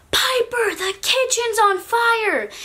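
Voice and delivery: high-pitched mock scream, drawn out; retold scream. Yelling to Piper about the kitchen fire, high mock scream. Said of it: "But I immediately screamed".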